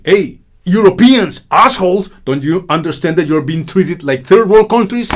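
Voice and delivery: high, loud mocking tirade; hammed-up angry call-out; scornful shout. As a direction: yell it at Europe with contempt. Voicing a rebuke to Europeans.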